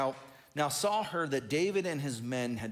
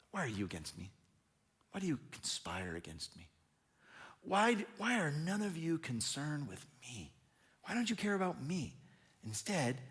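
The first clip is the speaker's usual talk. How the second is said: softer, sulking, wounded questions; hushed self-pity; 6 dB quieter than usual. Acted. Preacher voicing Saul pleading with his men, hushed and self-pitying. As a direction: let him wallow quietly.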